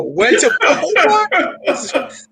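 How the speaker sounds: drawn-out, laughing disbelief; shocked laugh. Real, not acted. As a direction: stretch it out, amazed and laughing. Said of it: "I'm fucking controlling myself".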